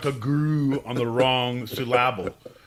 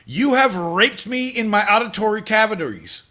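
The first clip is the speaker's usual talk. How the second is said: high, declaimed indignation; theatrical righteous outrage; mock outrage; 8 semitones higher, 4 dB louder than usual. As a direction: accuse them grandly, hammed up. Acted outrage at band.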